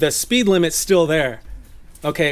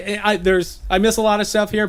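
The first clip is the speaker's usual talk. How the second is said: usual speaking voice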